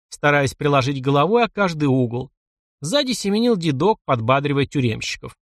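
ordinary delivery, nothing marked

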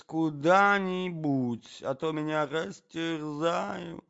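high, stretched, plaintive voice; flustered old-fashioned gentleman pleading; bewildered prince. A narrator's reading. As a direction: ramble helplessly, dragging out the words.

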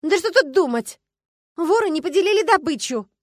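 high, loud and jittery impatience; nervous, agitated. A narrator's reading. Starkova to the police about the thieves, nervously impatient. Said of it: "нервно сказала Аграфена Дамировна".

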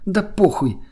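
high, reckless exclamation; giddy carefree retort. A narrator's reading. Laughing girl brushing off her lover's warning, high and giddy. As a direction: toss it off gleefully, pitched well up. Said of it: "продолжила хохотать девушка".

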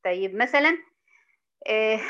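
ordinary talk

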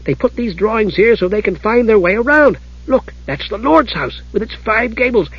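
high, eager kender chatter; excited. A narrator's reading. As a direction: rattle off discoveries in a perky high voice. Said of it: "said Tass excitedly".

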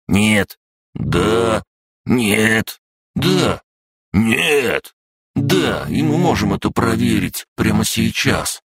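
put-on high-pitched childish bickering; squabbling. A narrator's reading. Creatures bicker back and forth. (упрямо пререкаясь)

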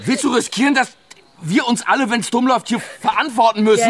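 high, insistent challenge; urgent pressing. Acted. Woman presses her guilt-ridden friend, high and urgent. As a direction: press her hard to see the risk. Heated argument over the dead man.

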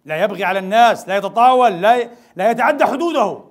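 loud, rising sermon emphasis; forceful preaching. Real, not acted. Preacher thundering at the congregation, loud and insistent. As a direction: drive the point home from the pulpit.

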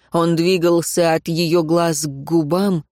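plain reading voice

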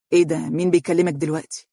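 ordinary delivery

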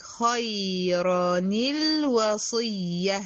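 خيرًا الوصية slow with the nun joined: khayran-il-wasiyya; tajweed demo. Shown as a demonstration. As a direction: join the tanween into الوصية. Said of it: "خلي بالك". (وصل التنوين بكسر النون)

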